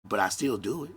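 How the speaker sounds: usual speaking voice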